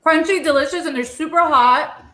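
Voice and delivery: high, loud talk through hot food; talking with a burnt mouth. Real, not acted. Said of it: "'cause I just burned my mouth".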